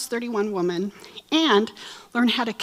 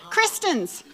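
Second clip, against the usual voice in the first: ordinary talk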